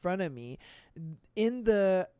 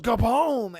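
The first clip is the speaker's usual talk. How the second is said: mock explosion; 3 semitones higher, 7 dB louder than usual. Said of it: "He just goes".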